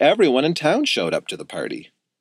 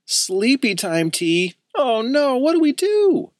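slow start, then rising into a high, alarmed cry; a worried boy getting more frantic; nervous panic; 13 semitones higher, slower than usual. A narrator's reading. Skylar panicking over the sleepy tea, nervous. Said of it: "Skylar looked nervous".

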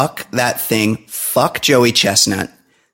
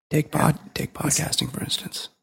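whisper; 5 semitones higher, 9 dB quieter than usual. Acted. Sarcastic trailing joke.

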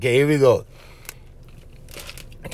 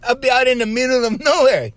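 ordinary talk

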